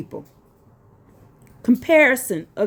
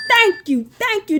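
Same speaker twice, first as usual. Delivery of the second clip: higher, jubilant thank you; triumphant thanks; 10 semitones higher than usual. Real, not acted. Preacher exulting that scripture backs him, triumphant. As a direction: celebrate being proven right.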